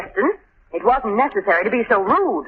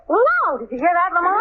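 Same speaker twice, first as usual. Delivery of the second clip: thrilled; 7 semitones higher than usual. Acted. Thrilled about police escort.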